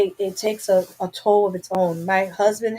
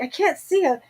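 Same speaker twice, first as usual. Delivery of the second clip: higher, alarmed; scared she can't see her child; pitched-up worry; frantic panic; 7 semitones higher than usual. Retold or imitated. Retold panic, can't see daughter.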